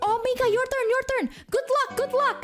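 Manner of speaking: very high, gushing auntie imitation; excited auntie. Aunt cheering Mika on for their turn, high excited squeal. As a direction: squeal encouragement like a thrilled aunt. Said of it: "And she was like".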